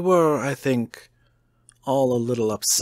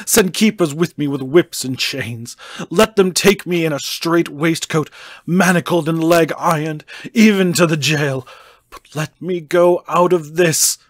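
rising, louder desperate begging; a madman begging to be let out, building to a shout; frantic pleading; 3 semitones higher, 7 dB louder than usual. A narrator's reading. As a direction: plead on your knees, growing louder and higher. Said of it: "poured forth a torrent of entreaty".

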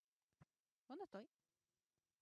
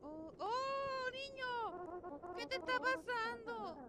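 much higher, louder alarmed cry; alarmed character voice; 8 semitones higher, 8 dB louder, slower than usual. Acted. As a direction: voice the character in shock. Voicing game character's shock.

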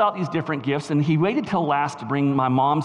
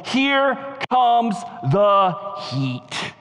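very slow, higher-pitched proclamation; climactic preaching; 4 semitones higher, much slower than usual. Real, not acted. Preacher applies the pepper analogy to the gospel, climactic drawn-out delivery. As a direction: space out every word for the climax.

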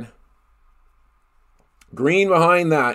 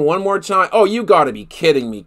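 ordinary delivery

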